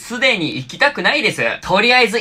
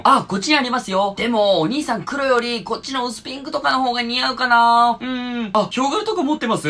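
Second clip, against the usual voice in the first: lightly voiced bright salesgirl; a hint of a pushy clerk; cheerful shop clerk; 5 semitones higher than usual.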